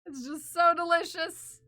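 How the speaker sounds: gushing over a snack. Acted. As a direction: rave about the taste. Hyping snack emote.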